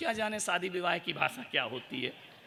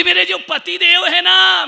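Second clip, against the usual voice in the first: much higher and louder, hammed-up yelling wife; mock shout; 12 semitones higher, 17 dB louder than usual. Wife yelling to the monk about her husband, hammed-up shout. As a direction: bellow it like an exasperated wife.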